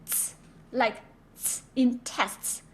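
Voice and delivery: ts drawn out slowly like the end of tests; voiced ts sound. Shown as a demonstration. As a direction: hold the ts buzz. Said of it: "C is".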